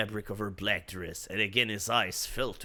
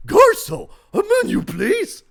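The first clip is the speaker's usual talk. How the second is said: haughty French call; 14 dB louder than usual. Acted. Bruce snaps for waiter.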